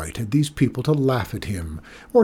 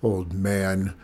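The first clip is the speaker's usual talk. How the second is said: low, affronted repetition; lowered voice, stung and indignant; indignant echo; 4 semitones lower, 4 dB quieter than usual. A narrator's reading. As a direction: echo the insult with contained fury.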